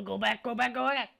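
loud, high, hurried go back; urgent shout. Real, not acted. Streamer panicking at his pizza design, shouting. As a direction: yell to undo it fast.

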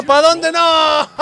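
high wail breaking into laughter; dismayed outcry. Real, not acted. Commentator seeing the shootout slip away, dismayed laughing cry. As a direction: cry out no and let it dissolve into laughing. Own team losing shootout.

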